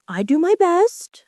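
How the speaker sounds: high, sulky and put out. A narrator's reading. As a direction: answer like a sulking servant. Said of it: "in a sulky tone".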